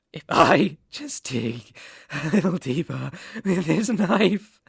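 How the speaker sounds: normal talking voice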